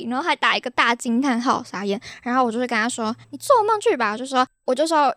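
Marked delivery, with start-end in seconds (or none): 3.3-4.1 mock dismissive. She brushes off pervert.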